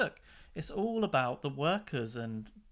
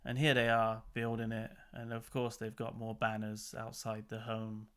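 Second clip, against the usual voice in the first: usual speaking voice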